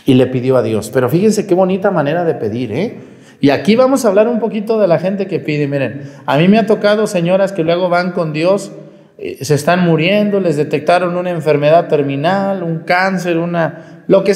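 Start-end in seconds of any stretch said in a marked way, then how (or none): none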